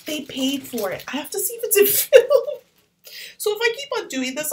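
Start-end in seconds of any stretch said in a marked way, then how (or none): 1.1-4.5 much higher, louder and faster, laughing; giggly excitement. Real, not acted. Checking the camera again, laughing. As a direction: bubble over with giggles.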